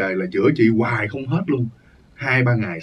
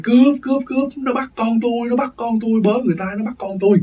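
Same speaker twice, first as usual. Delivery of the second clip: much higher, frantic cry of thief; retold panicked cry; 11 semitones higher than usual. Mother screaming in her nightmare as someone takes her child, high panicked cry. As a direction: imitate mother calling for help in terror, pitched way up. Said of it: "mẹ mới la lên".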